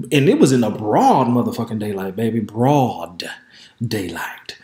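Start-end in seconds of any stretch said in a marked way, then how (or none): 2.4-4.6 hushed. Real, not acted. Scandalized hushed telling.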